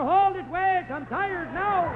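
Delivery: high, loud, slowed-down holler; hollering woman. Retold or imitated. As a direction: yell it out like a fed-up passenger. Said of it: "She'd holler".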